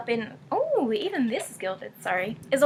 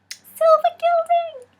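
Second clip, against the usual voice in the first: high, loud, drawn-out squeal; excited squeal; 7 dB louder, slower than usual. Real, not acted. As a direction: squeal the words with joy. Delighted by gilding.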